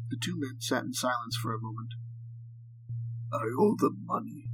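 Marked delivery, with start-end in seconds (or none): 3.3-4.5 put-on captive with a heavy Russian accent; thick Russian accent. A narrator's reading. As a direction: speak English like a Russian prisoner. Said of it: "his thick Russian accent coming through".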